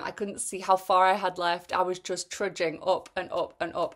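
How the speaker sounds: normal talking voice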